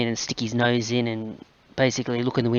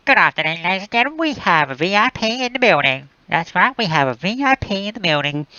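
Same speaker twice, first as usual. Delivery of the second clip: intercom voice; 7 semitones higher than usual. Retold or imitated. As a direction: announce it over the hospital tannoy. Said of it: "you get the whole intercom voice going".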